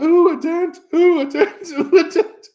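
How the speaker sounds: imitating a nervous girl backing off, mock frightened; mock scared squeal. Girls afraid of the hot glass.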